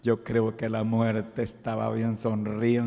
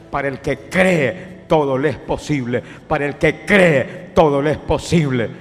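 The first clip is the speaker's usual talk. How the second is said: predicación fuerte y sostenida con gritos; predicación a pleno grito; 6 semitones higher, 15 dB louder than usual. Acted. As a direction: empujar a creer en el milagro. Predica a la audiencia con fuerza. (el predicador grita a quien lo escucha)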